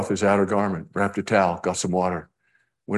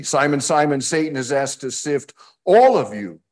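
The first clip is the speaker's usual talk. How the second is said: emphatic reading; 6 semitones higher, 4 dB louder than usual. Acted. Reading NIV verse.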